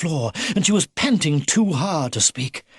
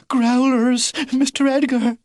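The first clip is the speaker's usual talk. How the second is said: a touch of breathless high panting; 9 semitones higher, 3 dB louder than usual. A narrator's reading. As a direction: gasp it out between breaths. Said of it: "she panted".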